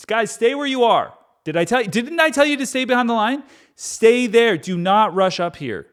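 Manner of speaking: high, loud, fast scolding; exasperated calling out. Acted. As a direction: yell at the crowd to stay put. Moses yelling at crowd.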